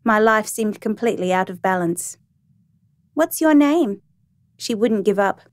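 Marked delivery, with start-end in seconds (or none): 3.1-4.0 put-on high, inquisitive child's voice; little girl. A narrator's reading. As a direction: ask like a curious small child.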